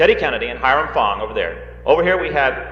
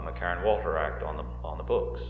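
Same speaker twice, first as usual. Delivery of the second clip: lightly voiced Secretary of State, lower and softer; Dean Rusk impression; 7 semitones lower, 12 dB quieter than usual. Quoting Rusk to the audience, lowered, softer impression. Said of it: "that accent just, uh, uh, slipped out when you, uh, listen to him".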